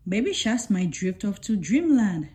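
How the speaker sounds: plain reading voice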